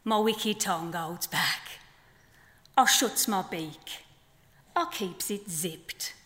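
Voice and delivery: measured poem delivery with long pauses; poem reading. Acted. Poet reading her flamingo poem to the festival audience, measured. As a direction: let each line land, unhurried.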